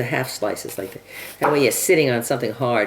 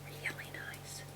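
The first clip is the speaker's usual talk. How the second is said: soft admiring whisper; 20 dB quieter, slower than usual. Real, not acted. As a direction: breathe it out in a whisper. Admiring the sliced onion quietly.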